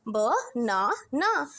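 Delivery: ब, ना, ना as three separate beats; split into syllables. Shown as a demonstration. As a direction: break the word into ब, ना and ना with gaps. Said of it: "chunks में divide कर दो".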